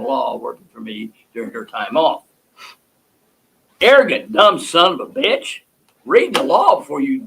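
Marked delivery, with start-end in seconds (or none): 3.8-7.3 louder, furious insult flung out; angry outburst. Real, not acted. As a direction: spit the insult in real anger. Host rants at prosecutor.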